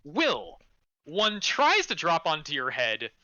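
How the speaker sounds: usual speaking voice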